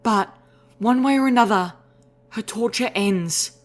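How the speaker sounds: high, firm, slowing at the end; a gentle mare turned steely; resolute mare voice. Acted. Velvet Remedy's resolve.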